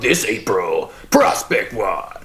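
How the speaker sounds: slow, booming promo announcer; monster truck ad. Pretend. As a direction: hype it like a monster truck commercial. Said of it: "Like monster truck commercials".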